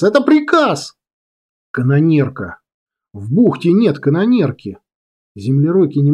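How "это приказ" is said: high-pitched, insistent order; full-voiced yell. A narrator's reading. Girl overriding stunned commander, shouted order. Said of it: "Кира кричит в голос".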